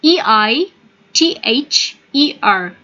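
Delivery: "E I T H E R" spelled slowly letter by letter; letter-by-letter spelling for the class. Shown as a demonstration. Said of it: "we have the word here".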